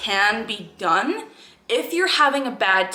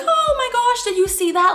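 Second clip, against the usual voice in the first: high, loud gushing mock voice; sarcastic squealed amazement; mocking excitement; 5 dB louder than usual. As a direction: parody someone thrilled by their own tic. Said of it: "I wouldn't be like".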